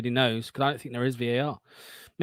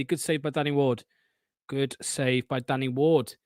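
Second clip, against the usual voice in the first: ordinary talk, nothing marked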